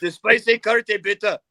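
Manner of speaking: hammed-up harsh German request; mock scary German. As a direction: order the menu like a menacing officer. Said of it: "it sounds scary".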